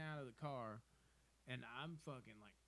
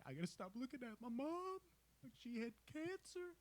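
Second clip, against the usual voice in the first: much higher, put-on voice retelling a heartfelt speech; imitating Greg Olson; 11 semitones higher than usual. Retelling Greg Olson's speech about his mom in a much higher put-on voice. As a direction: mimic Greg Olson going high and earnest. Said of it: "fucking Greg Olson's like".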